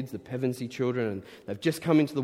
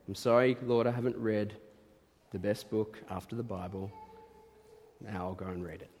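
mock confession; 4 semitones lower, 7 dB quieter than usual.

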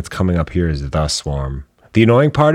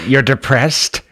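cartoon mom voice, climbing higher and louder; mock-worried sitcom wife, rising; Lois Griffin voice; 8 semitones higher, 4 dB louder than usual. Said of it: "I got nothing else as Lois".

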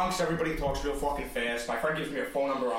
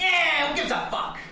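high, loud and drawn out; hammed-up loud brush-off; dismissive yell; 6 dB louder than usual. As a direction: holler it out slowly, pitched way up. Acted retort during the list.

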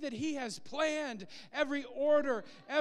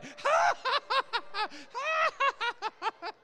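loud laughter; 9 semitones higher, 6 dB louder than usual. Real, not acted. Preacher laughing while acting.

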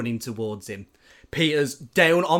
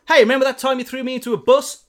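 higher, louder, fast cocky jab; taunting quip; 10 semitones higher, 8 dB louder, faster than usual. Retold or imitated. Spider-Man mocking Shocker as he swoops in, cocky and fast. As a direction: rub it in his face.

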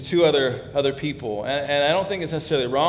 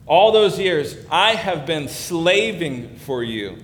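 louder, slow, bitter complaint; resentful older brother; 4 semitones higher, 6 dB louder, slower than usual. Acted. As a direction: lay the grievance on him, slowly. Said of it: "the older brother is disrespecting the father".

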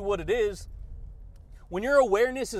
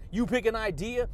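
ordinary talk, nothing marked

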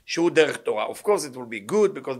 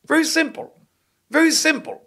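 emphatic answer; 11 semitones higher, 3 dB louder than usual. Real, not acted. Teacher answering own question.

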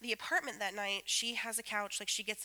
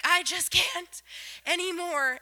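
loud exhausted cry; 8 semitones higher, 9 dB louder than usual. Retold or imitated. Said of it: "and I'm not being dramatic".

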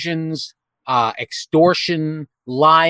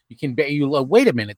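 normal talking voice

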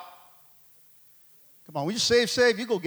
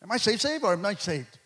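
saved said twice, then a plain saved, playful question; teasing stress on saved; 5 semitones lower, 6 dB quieter than usual. Shown as a demonstration. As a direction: weigh the two kinds of saved. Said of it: "saved, saved, or saved".

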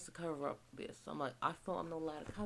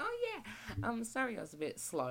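high, sheepish apology; high apologetic voice; 7 semitones higher, 4 dB louder than usual. Retold or imitated. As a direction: apologise bashfully. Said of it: "I'm like".

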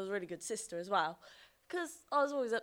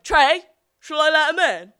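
much louder, higher stepdad calling; mock loud call; 7 semitones higher, 14 dB louder than usual. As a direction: call across the house, playing along. Stepdad pretends police at door.

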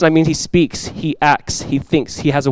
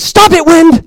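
put-on high, loud child's yell at the wind; imitating son's yell; 12 semitones higher, 7 dB louder, much slower than usual. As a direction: yell like an indignant little boy. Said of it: "he yells at the wind".

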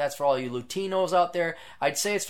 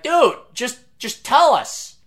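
higher, louder exasperated plea; impatient; 8 semitones higher, 9 dB louder, slower than usual. Retold or imitated. Fed up with a slow podcaster, a loud exasperated plea. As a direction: hurry him along, fed up. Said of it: "And I'm usually like".

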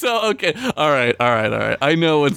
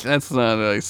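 ordinary talk, nothing marked

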